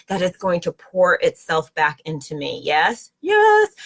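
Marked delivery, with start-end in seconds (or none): 3.2-3.7 high, loud jubilant yes; leaping up in pitch with delight; excited yes. Real, not acted. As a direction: burst out with a joyful yes. Affirming her message to viewers.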